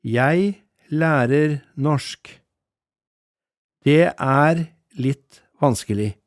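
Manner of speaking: very slow, every word clear; a teacher models both phrases together, very slowly. Shown as a demonstration. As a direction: lay the phrase out slowly for the listener. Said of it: "I'll say this slowly".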